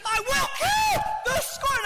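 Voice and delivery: very high, slow shouted vow; hammed-up hysterical battle cry; frenzied yell. Husband attacking the scorpion, frenzied yell.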